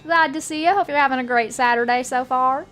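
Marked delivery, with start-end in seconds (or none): none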